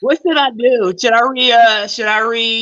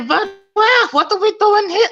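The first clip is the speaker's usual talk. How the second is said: high-pitched mock German accent; bad German accent; 11 semitones higher than usual. Comedian doing Christoph Waltz in Inglourious Basterds, high mock German accent. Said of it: "That's German. That's my German".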